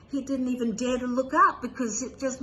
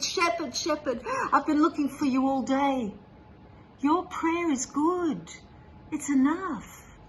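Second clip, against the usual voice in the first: eager call; 5 semitones higher, 3 dB louder than usual. A narrator's reading. Scholar runs up, urgent relief.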